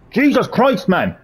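exasperated high yelp at the game; real exasperated cry; frustrated outburst. Real, not acted. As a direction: blurt it out in frustration. Stuck on ball puzzle, frustrated.

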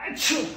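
fake sneeze. Sneeze on your dog trend.

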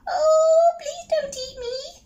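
high, slow squeaky mouse voice; little mouse. Acted. Mouse answers the troll under the bridge, high squeak. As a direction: squeak each word out slowly.

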